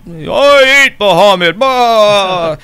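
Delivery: loud, high, slow gloating boast; the dragon taunts the gods, gloating. Acted.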